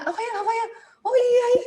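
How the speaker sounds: high-pitched, giggly delighted reaction; gleeful response. Retold or imitated. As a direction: act out eager delight at his story. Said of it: "tanggapi dengan gembira". (menanggapi dengan gembira, suara melengking)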